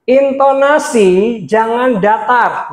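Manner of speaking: "intonasi jangan datar" drawn out slowly with swooping pitch; lively rising-falling intonation. Shown as a demonstration. Speaker teaches intonation to preachers, slow swooping tune. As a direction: stretch "intonasi" and "datar" in melodic waves. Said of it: "dari tadi saya nggak datar".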